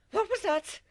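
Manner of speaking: loud startled high question; startled loud outburst; too loud. Acted. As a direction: blurt it out too loudly. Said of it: "I asked a little too loudly".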